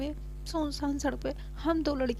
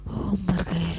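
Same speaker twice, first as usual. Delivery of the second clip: whispered sigh of relief; breathless whisper; 3 semitones lower, 5 dB louder, much slower than usual. Acted. Sarika catches her breath after pushing the scooter, whispered. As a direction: gasp it out on the exhale. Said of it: "गहरी सांस लेती".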